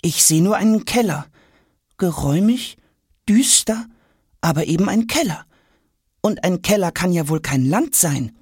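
put-on high boyish voice, sceptical; a boy unconvinced by the cellar; boy Jonas, doubtful. A narrator's reading. Boy telling the suitcases, sceptical.

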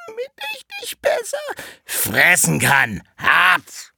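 from squeaky falsetto to loud wolf roar; wolf pouncing. Acted. Disguised wolf pounces on Rotkäppchen, falsetto bursting into a roar. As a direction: start as fake granny, break into a slow, loud devouring shout.

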